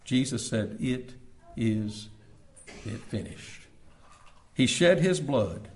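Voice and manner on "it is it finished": hushed last words. Retold or imitated.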